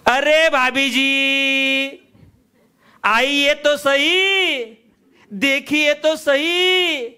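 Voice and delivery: high, very slow coaxing hawker. Retold or imitated. Pushy hawker coaxing the refusing housewife, slowly and sweetly. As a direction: wheedle her to come look. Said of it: "मग तो लगेच जातो का?".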